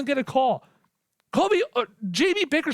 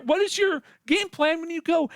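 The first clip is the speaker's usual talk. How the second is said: ordinary delivery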